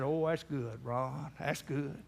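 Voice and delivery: low, soft and mild; soft old man. Retold or imitated. Grandpa answers his grandson softly, quiet. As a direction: murmur it gently like an old grandpa.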